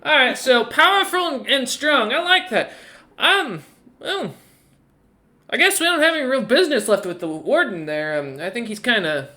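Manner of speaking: high, swooping teasing voice; playful woman rogue. Acted. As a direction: lilt up high as a cheeky lady rogue.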